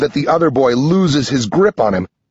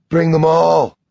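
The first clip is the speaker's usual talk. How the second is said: higher, held-out eerie command; evil, possessed voice; 5 semitones higher than usual. A narrator's reading. Evil speaking through Sam to John, strange and raised. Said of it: "something evil is speaking through him".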